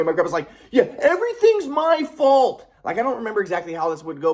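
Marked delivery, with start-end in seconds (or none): 0.7-2.7 high, slow mocking old man voice; sarcastic grandpa. Grandpa mocking his grandson's blame, sarcastic. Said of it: "my grandpa would say stuff".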